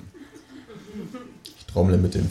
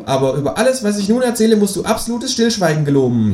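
high, solemn creature voice; Känguru; 11 semitones higher, 3 dB louder than usual. A narrator's reading. Kangaroo demands companion's silence, high insistent voice. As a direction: lay down the terms in a high voice. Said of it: "sagt das Känguru".

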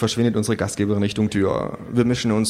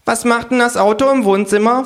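indignant kangaroo; 13 semitones higher, 6 dB louder than usual. Acted. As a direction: fume like a cheeky kangaroo. Kangaroo figure.

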